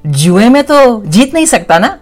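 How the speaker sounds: put-on high, fast, blundering young man; Jay voice. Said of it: "could you do the Sholay Mausi one for me".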